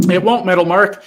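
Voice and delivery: loud, high burst at a commenter; real yelling. Real, not acted. Livestreamer shouting back at a commenter, loud and high. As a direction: raise your voice hard on won't. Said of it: "I'm not yelling at you".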